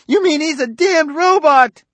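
very high, loud, outraged shriek. A narrator's reading. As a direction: shriek it in horror, right at the top of the voice. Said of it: "shrieked Clusar".

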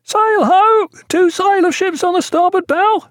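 high, loud lookout's cry from the masthead; screaming hail. A narrator's reading. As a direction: bellow it down from aloft. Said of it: "the foremast lookout's screaming hail".

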